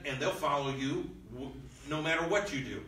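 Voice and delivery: normal talking voice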